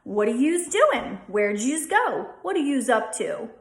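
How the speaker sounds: Philly "yous" said as yooz; casual Philly "yous" question; Philly accent. Shown as a demonstration. Teacher gives a Philly example line in a Philly accent. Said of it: "So in Philly, it's gonna be use".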